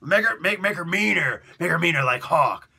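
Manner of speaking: loud, pushy boss repeat; boss insisting. Retold or imitated. Boss pressing the writer to make Dove meaner, loud. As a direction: hammer the demand home.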